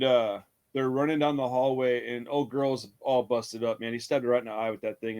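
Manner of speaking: normal talking voice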